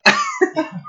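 laughing through the words, pitch jumping high. Real, not acted. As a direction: giggle out an embarrassed excuse. Laughing at his own invented name.

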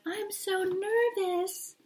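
high, slow breathy mock-nervous girl; fake nervous voice. Mother mocking a contestant's fake nervous voice. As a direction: mimic her put-on little-girl voice. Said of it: "She's got on her fake voice".

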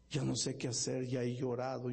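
ordinary talk, nothing marked

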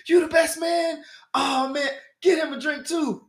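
hammed-up rich guy thrilled; excited shout. Stranger excited at news.

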